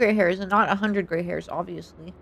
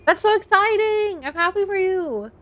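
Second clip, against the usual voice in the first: excited congratulations; 9 semitones higher than usual. Real, not acted. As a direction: gush for a friend, voice way up. Congratulating a viewer warmly.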